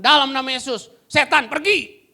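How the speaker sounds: loud, high command to the demon; commanding exorcism. Retold or imitated. Preacher casting out a demon, loud command. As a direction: order the demon out with authority. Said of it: "Saya cuma bilang".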